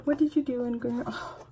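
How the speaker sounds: tripping over words; stuttering. Real, not acted. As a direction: stammer in excitement. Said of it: "I'm, like, stuttering on my words".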